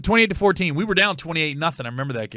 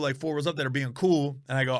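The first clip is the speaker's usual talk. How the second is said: ordinary talk, nothing marked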